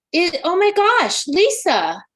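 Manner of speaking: oh my gosh, high and loud; thrilled, almost squealing; excited gasp. Real, not acted. Medium seeing the spirit appear, excited outburst. Medium sees the departed mother.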